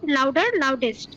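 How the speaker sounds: ordinary delivery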